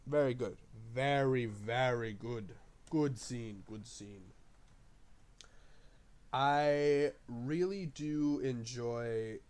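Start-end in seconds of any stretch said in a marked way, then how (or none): 3.6-4.3 much softer, almost to himself; hushed afterthought echo; quiet aside. Shown as a demonstration. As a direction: drop it to a murmured echo. Soft repeat of the praise.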